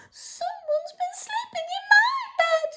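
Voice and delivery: very high whispered squeak; squeaky whispered Baby Bear. Acted. Storyteller voicing Baby Bear, a high whispered squeak. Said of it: "squeaked Baby Bear".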